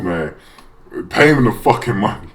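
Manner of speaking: ordinary talk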